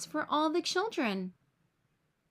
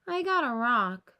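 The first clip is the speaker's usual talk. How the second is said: sadly; 4 dB louder than usual. A narrator's reading. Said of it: "says Charlie Brown sadly".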